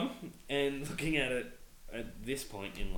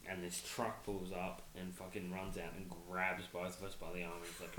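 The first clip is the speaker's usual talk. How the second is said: ordinary talk, nothing marked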